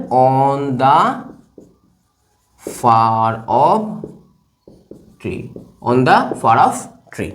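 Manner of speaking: slow, with long gaps between words; drawn-out dictation. Shown as a demonstration. Teacher writing "on the far off tree", drawn-out dictation. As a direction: spell it out word by word at writing speed.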